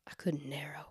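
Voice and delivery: very soft whispered admission. Real, not acted. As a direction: breathe it out in a whisper. Rueful aside about list.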